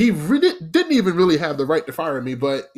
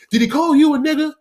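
sharp, much higher angry question; scolding manager; 12 semitones higher, 5 dB louder, faster than usual. Retold or imitated. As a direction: fire it back at the server, pitched way up. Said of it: "He said".

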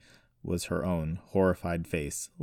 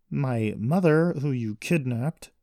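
slow, loud, higher, disgusted accusation; open disgust; 7 semitones higher, 6 dB louder, slower than usual. A narrator's reading. Daughter accusing brother of kidnapping, open disgust. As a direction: spell it out with disgust. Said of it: "not trying to hide the disgust".

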